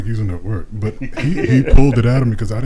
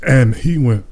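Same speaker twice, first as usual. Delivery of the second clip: ordinary talk, nothing marked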